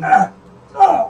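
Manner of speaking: loud pained cries; yelping at a leg cramp. Real, not acted. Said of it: "having a leg cramp".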